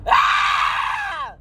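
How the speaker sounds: very high shriek; high scream. Real, not acted. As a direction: cut loose with a crazy yell. Screams about crazy family.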